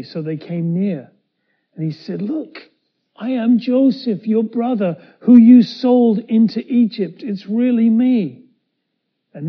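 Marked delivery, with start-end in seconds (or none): 2.2-8.4 urgent reassurance. Acted. Joseph pleads to be believed.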